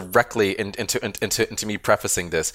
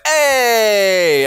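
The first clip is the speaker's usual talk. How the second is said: excited cheer; 11 semitones higher, 6 dB louder than usual. Retold or imitated. Audience cheering at star.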